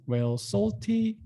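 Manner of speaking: ordinary talk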